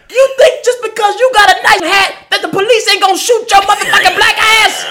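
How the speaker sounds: shrill high shouted rant at a stranger; imitating her snap. Man mimicking a solicitor yelling after him on the street. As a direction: yell it like the furious solicitor. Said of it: "I hear her snap".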